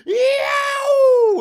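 very high, loud whooping "Yow!"; wild concert yell. Retold or imitated. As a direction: let out a fan's howl at the band. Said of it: "I'm just like".

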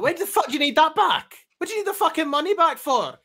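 high, very loud sweary outrage; explosive comic impression, demanding an answer; Woody. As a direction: shout it as an incensed Scottish character. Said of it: "I'm doing a Woody impression".

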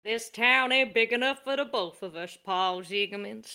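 cowboy showdown in a Texan drawl; Texan drawl, like a Western standoff; a Texan gunslinger's challenge. Acted. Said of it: "Texan kind of drawl".

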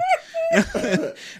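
squealing high cackle; high cackling laugh. Real, not acted. Cracking up at his wife's mistake, a high squealing laugh. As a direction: break into a shrieking laugh.

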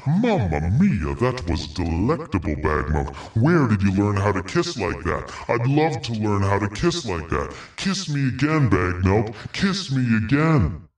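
over-the-top romantic swoon, slow on "Mamma mia"; swooning Tyler. Retold or imitated. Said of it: "Tyler leans back and go".

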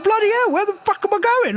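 high mock scream of a man floating away; retold scream. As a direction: yell it like you're drifting off into nothing, hammed up. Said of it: "he's screaming for his life".

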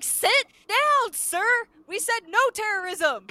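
high, loud reprimand; hammed-up exasperated order; mock furious judge. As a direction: shout the lawyer down from the bench. Acting judge scolding lawyer.

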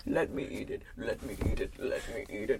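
low whispered pleading. Real, not acted. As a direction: whisper it over and over. Last chip, repeated.

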